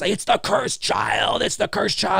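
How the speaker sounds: high, hushed mock cry; softly hammed-up accusation; accusing townsfolk. Voices townsfolk accusing Jamie.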